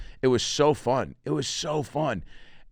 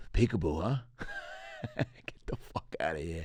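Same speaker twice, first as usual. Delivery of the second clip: low, quiet, slow and jaded; smoking toddler; 3 semitones lower, 12 dB quieter than usual. Acted. Jaded toddler smoker to a peekaboo player, unimpressed. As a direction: judge them through a drag of cigarette. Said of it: "like he was smoking a cigarette".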